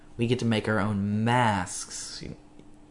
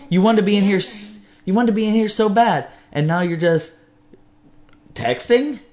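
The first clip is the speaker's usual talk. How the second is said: loud, higher, exasperated mock telling-off; mock scolding; 8 semitones higher, 5 dB louder than usual. Host tells off the engineer for texting during the podcast, mock outrage. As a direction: scold in put-on disbelief, voice raised.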